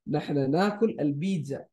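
fast, fluent Arabic read; quick Arabic model. Shown as a demonstration. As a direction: rattle off the Arabic line. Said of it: "let's read".

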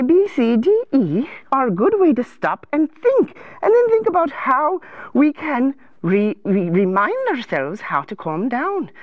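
lilting, gently concerned advisor voice; sing-song storybook helper; fussing counselor. Acted. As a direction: coo at a troubled little king.

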